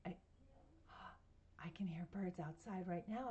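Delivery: quiet whisper to hear birds. Real, not acted. Telling the child she hears birds outside, whispering. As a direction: whisper so the birds stay audible.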